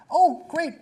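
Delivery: high, loud, over-eager; hammed-up enthusiasm; mock eager. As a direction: gush it like an excited parent. His own eager reply quoted.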